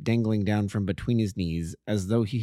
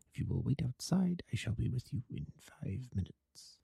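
much softer, kept low and secretive; hushed voice; 7 dB quieter than usual. Acted. Holmes quietly instructs Watson in the opium den, hushed. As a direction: murmur so the den cannot overhear. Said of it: "As low as you can".

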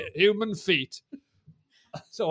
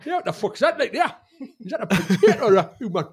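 put-on higher Geordie accent, laughing; 5 semitones higher, 3 dB louder than usual. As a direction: ham up a Geordie voice about the potato. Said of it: "You can do your accent again".

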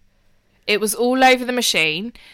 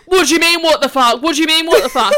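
high, loud, defensive squawk; mock indignant brother; 7 semitones higher, 8 dB louder than usual. Brother defending himself to sister, mock indignant. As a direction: throw it back as if he's offended. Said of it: "And Malik's like".

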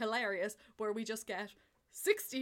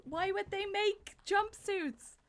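mock incredulous; 10 semitones higher, slower than usual. Mocking the complaint.

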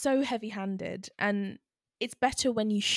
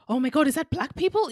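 quick, higher mock-awed kid; mock amazement; 6 semitones higher, 4 dB louder, faster than usual. Kid looking at the sky, mock amazement. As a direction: rush it in exaggerated wonder. Imagined heavy-handed kid's line, mocked.

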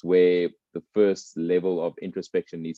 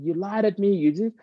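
mock shout; 13 semitones higher than usual. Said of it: "starts shouting at him".

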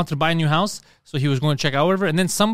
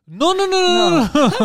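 high, loud, hammed-up Italian "no, no, no"; a hammed-up Italian "no, no, no" from a friend, pitched high; mock Italian protest; 13 semitones higher, 3 dB louder, slower than usual. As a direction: wave it off like a cartoon Italian.

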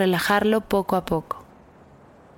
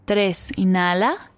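slow breath counting; 3 semitones lower, slower than usual. Acted.

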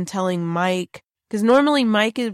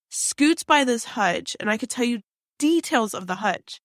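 ordinary talk, nothing marked

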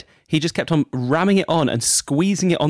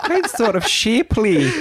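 a light mock Scottish accent, slow and laughing; 9 semitones higher, 4 dB louder, slower than usual. Co-host copying the Scottish baker from the clip, mock Scottish accent. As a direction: say it back in a Scottish lilt, drawn out. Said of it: "looking forward to your Scottish accent".

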